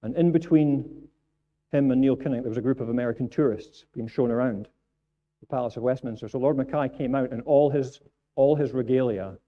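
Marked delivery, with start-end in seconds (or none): none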